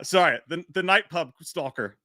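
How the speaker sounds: ordinary delivery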